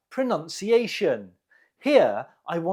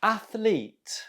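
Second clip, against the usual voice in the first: "Athlete" stressed on ATH, higher pitch; first-syllable stress; 5 semitones higher than usual. Shown as a demonstration. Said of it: "The stress is on the first syllable".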